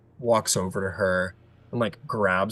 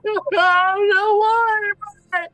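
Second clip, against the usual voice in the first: high, loud grief-stricken wail; stretched, sobbing cries of protest; crying wail; 9 dB louder than usual. Acted. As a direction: wail over the body. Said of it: "crying over".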